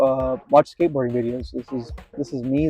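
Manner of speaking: ordinary delivery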